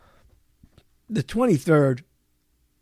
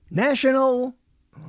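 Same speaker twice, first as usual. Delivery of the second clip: ordinary talk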